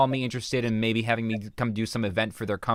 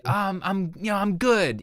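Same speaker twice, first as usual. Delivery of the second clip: higher, unsure "I'm good"; hesitant stock reply; 8 semitones higher than usual. Retold or imitated. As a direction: fumble a polite answer.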